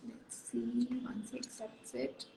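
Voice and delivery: whispered aside to self. Real, not acted. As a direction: murmur under the breath, voiceless. Aside while waiting for acceptance.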